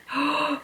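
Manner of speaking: quick, high intake of breath; surprised gasp. Real, not acted. Checking if the clings are old, surprised gasp. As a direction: gasp mid-sentence in amazement.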